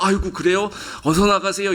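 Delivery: hammed-up king caving in at once; mock obliging Pharaoh. Pharaoh imagined meekly agreeing.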